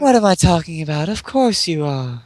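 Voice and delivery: high, dreamy voice from the past; flashback voice. Pretend. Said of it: "I'm doing flashback voice".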